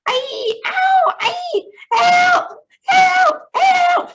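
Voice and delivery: shrill screams of terror, very high and loud; ghastly screams. A narrator's reading. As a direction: scream for help as if being eaten. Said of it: "ghastly screams and yells".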